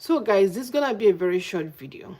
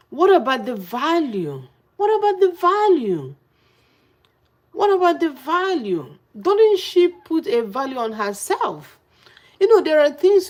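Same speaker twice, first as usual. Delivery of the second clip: high-pitched, insistent challenge; indignant; 7 semitones higher, 5 dB louder than usual. Real, not acted. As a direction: demand an answer, pitch climbing. Indignant rant about a woman's self-respect.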